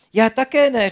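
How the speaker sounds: high, loud eager reply; eager agreement. A narrator's reading. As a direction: chime in excitedly. Šibalka excited about beach.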